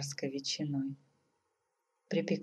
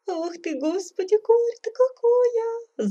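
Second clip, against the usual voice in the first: very high, theatrical sobbing wail; sobbing lament; 6 dB louder than usual. A narrator's reading. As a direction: burst into a hammed-up high wail. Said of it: "зарыдала царица". (рыдая, причитая высоким голосом)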